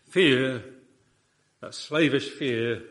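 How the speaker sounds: loud, slow weight on "Fear"; emphatic preaching. Real, not acted. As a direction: hammer the word "Fear" home.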